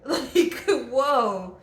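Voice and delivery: whoa said through a laugh; amused laughing whoa. Real, not acted. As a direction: laugh it off in disbelief. Laughs at the idea.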